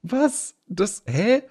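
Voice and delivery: totally lost by the news; confused huh. Retold or imitated. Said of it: "Und ich war so".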